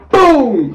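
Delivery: loud, high boom; boom impact sound. Retold or imitated. Describing the tackle, loud boom. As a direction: slam out a boom. Car rams garage.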